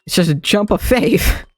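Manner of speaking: normal talking voice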